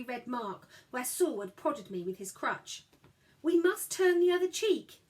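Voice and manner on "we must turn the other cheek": pious. Acted. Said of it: "parroting the Bible".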